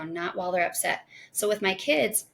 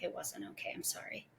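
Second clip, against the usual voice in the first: very soft, almost whispered apology; quiet apology; 18 dB quieter than usual. Retold or imitated. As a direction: imitate a child admitting fault under their breath. Calmed daughter apologizing.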